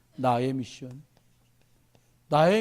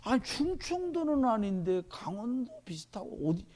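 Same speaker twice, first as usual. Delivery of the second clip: slow, softer wondering aloud; puzzled guessing; 3 semitones higher, 6 dB quieter than usual. Retold or imitated. Preacher recalling how he tried to place a dialect, slow and puzzled. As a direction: replay your own head-scratching over an accent, drawn out. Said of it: "제가 이 귀가 축복받았거든요".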